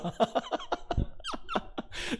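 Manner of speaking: real laughter after the remark; amused laugh. Real, not acted. Pastor on the wilful contractor, helpless laugh.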